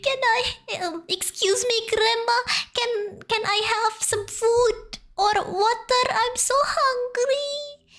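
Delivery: put-on starving boy begging the old woman for food and water; pleading starving boy. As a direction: beg like a weak, hungry child.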